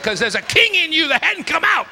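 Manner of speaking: loud high shout at his son; shouting a father's challenge; retold shout. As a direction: yell it out to wake him up.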